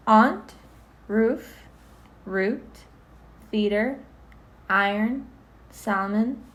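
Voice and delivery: each word lifted into a question; rising on every word. Acted. Reading the word list back to the interviewer, every word rising. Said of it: "You pronounce those things interestingly".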